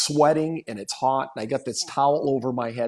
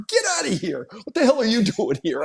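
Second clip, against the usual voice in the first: higher, incredulous retold outburst; mock outraged disbelief; 8 semitones higher than usual. Player finding the dentist at his stitches, incredulous. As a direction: act out being stunned and annoyed. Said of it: "I was so mad".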